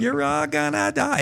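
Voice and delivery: very high, hammed-up doom cry; creepy pastor, over-the-top. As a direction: imitate a crazed pastor's warning. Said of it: "he turned it up to eleven".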